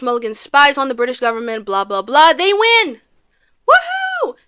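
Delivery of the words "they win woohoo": high, whooping victory cry; celebratory cheer. Pretend. Recapper celebrating America winning the war, excited cheer.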